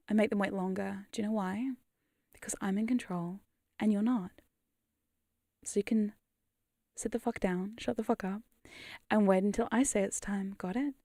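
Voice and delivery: softly spoken, controlled command; quiet domination. Pretend. Hypnotist putting impatient chat in its place, quietly commanding.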